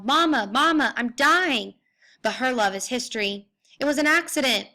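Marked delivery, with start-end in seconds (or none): 0.0-1.8 higher, louder anguished howl for mother. Acted. Grendel bleeding, crying for his mother, louder and higher. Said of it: "I howl". 3.8-4.7 higher bellow of defiance. Acted. Grendel shouting back at the hall, a higher bellow. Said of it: "I bellow back".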